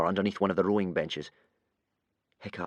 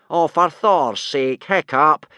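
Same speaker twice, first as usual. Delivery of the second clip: higher, loud, slow exasperated shout; exasperated yell; 6 semitones higher, 9 dB louder, slower than usual. A narrator's reading. Teacher yelling at his struggling pupil, exasperated. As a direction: yell in frustration. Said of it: "yelled Gobber in exasperation".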